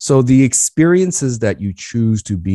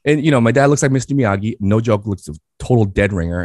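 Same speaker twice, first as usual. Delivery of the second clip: normal talking voice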